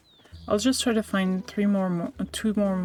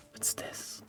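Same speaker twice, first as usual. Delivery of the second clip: whispering; 22 dB quieter than usual. Real, not acted. Whispering in awe at game.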